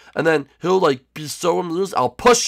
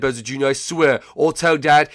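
ordinary talk, nothing marked

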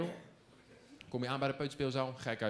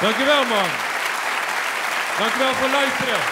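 loud, higher thanks to the audience over the crowd; a comedian closing his set, calling out; stage sign-off; 7 semitones higher, 14 dB louder than usual. Real, not acted. (luid bedankje aan het publiek)

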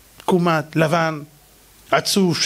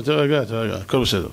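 calm reassurance; 8 semitones lower than usual. Acted. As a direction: soothe him gently. Elisha calms servant.

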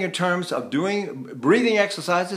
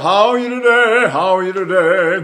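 overdone singer speech; 3 semitones higher, 8 dB louder than usual. Shown as a demonstration. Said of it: "So I go".

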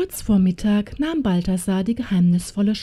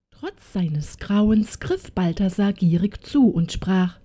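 plain reading voice

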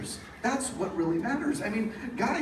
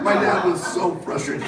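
ordinary talk, nothing marked

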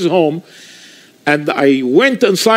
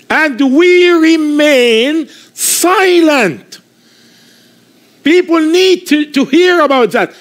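higher-pitched preaching, pressing the good news; fervent preaching; 5 semitones higher than usual. Acted. Pastor rousing his congregation to share news, fervent preaching. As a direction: urge the crowd like a street preacher.

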